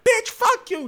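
high squeaky imitated insult; mock fighting girl. Girl about to start punching, mock angry. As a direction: imitate her cursing before she swings.

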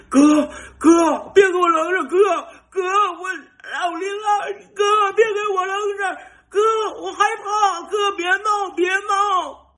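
very high, loud, panicked wailing cry; terrified crying shout. Retold or imitated. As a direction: scream for your big brother not to leave you. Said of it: "就听倒数第一搁后边喊". (被扔在黑暗里的弟弟带着哭腔高声惊恐大喊)

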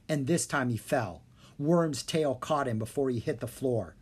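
ordinary delivery, nothing marked

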